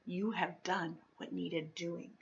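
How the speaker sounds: soft, slow and grave; a hushed, tender thank-you; quiet, slow, sincere; silent gratitude. A narrator's reading. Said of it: "her eyes told him".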